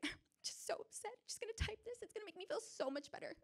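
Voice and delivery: hammed-up sulky self-pity; high breathy mock sulk; mock whiny self. Mock-upset self typing resignation.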